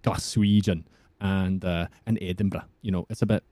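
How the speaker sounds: Scottish accent with rolled R's. Shown as a demonstration. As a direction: explain in a put-on Scottish burr. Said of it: "I kind of sound like [name]".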